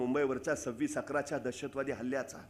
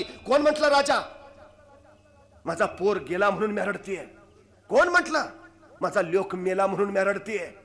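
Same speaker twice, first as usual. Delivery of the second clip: higher, louder sharp protest; fierce retort; 6 semitones higher, 9 dB louder than usual. Acted. Mother sharply rebuking the king's comfort, loud fierce retort. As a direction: fire back, refusing his pity. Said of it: "तार दिशी ... म्हणाली".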